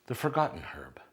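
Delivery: mock-mysterious whisper; hushed slogan. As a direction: whisper it like a secret tagline. Mock-dramatic slogan for dill.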